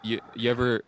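ordinary talk

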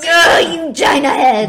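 put-on loud, laughing kid insult; half-laughing mock yell; mock child shout. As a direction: yell it like a cross six-year-old. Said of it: "that you go".

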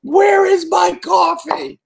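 hammed-up hunt for coffee; desperate caffeine fiend. Retelling his morning coffee craving, mock desperate yelling. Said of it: "I'm just like".

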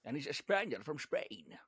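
put-on slushy Scottish S in a supposed Spanish accent; Sean Connery doing Spanish. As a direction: slip into a bad Spanish accent, S turning to SH. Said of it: "That's exactly how he talks".

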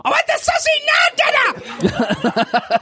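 very high-pitched screaming tantrum; child's screamed demand. Acted. Child demands food from dad, screamed tantrum. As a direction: screech it like a furious impatient small child. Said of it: "of course, they want it now".

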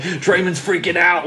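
ordinary talk, nothing marked

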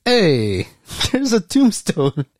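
high, slow, a touch mocking; light deadpan joke; mock casual reveal. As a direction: point it out far too casually. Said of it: "Or it's just like".

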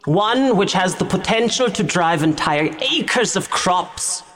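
high, grand podium address; Timberland Geist's loud speech. Acted. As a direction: proclaim it to a hushed crowd. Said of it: "a loud voice from a podium that begins".

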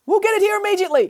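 high, fast promise; hurried cry. Acted. Foreman to Nanny Piggins, hasty high cry. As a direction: rush the words out in a panic. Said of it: "cried the foreman".

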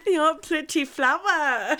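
high-pitched hammed-up salon accent; caricatured foreign-English salon accent; mock nail-salon accent. Hosts playing nail technicians pushing a design, mock accent.

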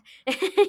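short high, loud laugh. Real, not acted. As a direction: laugh at your own bit. Laughs after acted quote.

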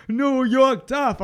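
high, drawn-out mock drunk; drunk mimicry. Imitating a drunk speaker at a live press conference, mock drunken. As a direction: stretch it out like a tipsy politician. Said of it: "they looked hammered and they looked drunk".

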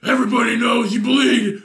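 loud, hoarse superhero shout; Batman. Acted. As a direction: roar it in a vigilante's rasp. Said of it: "I get my best Batman voice".

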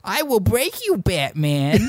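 high, garbled mumbling villain voice; mangled Bane voice. Pretend. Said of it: "could you imagine if Bane talked like that".

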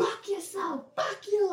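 very high, softened imitation of a woman telling someone off; squeaky retold telling-off; mock cursing. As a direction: put on a high voice for the insult.

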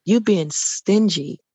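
slow, measured reproach; God. Retold or imitated. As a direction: lay down the charge slowly and evenly. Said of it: "I can hear God saying that to me".

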